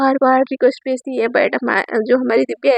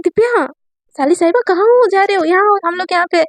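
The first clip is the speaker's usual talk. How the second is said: calling out; 5 semitones higher than usual. Acted. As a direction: hail her from a distance. Brother-in-law calling across mall. (मॉल में दूर से साली को पुकारता जीजा)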